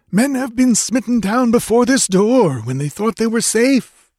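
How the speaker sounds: high, tense voice of the guide; frightened guide Tekodl. A narrator's reading. Tekodl answers Conan without looking back, high and tense. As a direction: pitch up high for the nervous guide.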